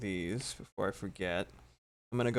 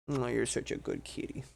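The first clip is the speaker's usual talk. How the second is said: talking to a cat; 4 semitones higher, 8 dB louder than usual. Real, not acted. Praising her cat.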